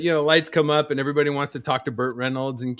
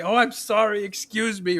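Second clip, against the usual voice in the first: higher-pitched mock apology; apologetic latecomer; 7 semitones higher than usual. Sweaty guy squeezing past seated theatergoers, mock apologetic. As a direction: imitate someone excusing himself past everyone.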